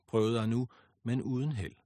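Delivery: usual speaking voice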